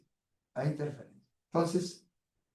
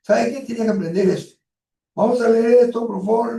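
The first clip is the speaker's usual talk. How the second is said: blunt commands; 6 semitones higher, 4 dB louder than usual. Shown as a demonstration. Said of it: "le enseña de frente".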